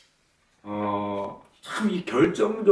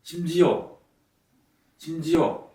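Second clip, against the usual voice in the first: ordinary talk, nothing marked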